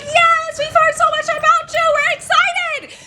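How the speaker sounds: high, loud chorus-like gushing; excited kids. Retold or imitated. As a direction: imitate children greeting a relative eagerly. Kids answer mom eagerly, acted.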